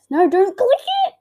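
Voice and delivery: loud, high-pitched urgent warning; alarmed shout. Real, not acted. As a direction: shout to stop someone right now. Sudden alarm over the cursor.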